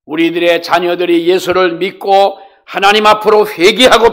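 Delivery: loud, emphatic sermon delivery; forceful preaching. Real, not acted. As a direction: preach a grave warning at full force.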